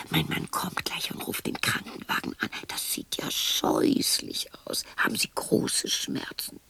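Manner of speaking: hushed, worried whisper; whispered reassurance. Acted. Woman whispering to the injured visitor that help is coming. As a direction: whisper it close to the hurt visitor.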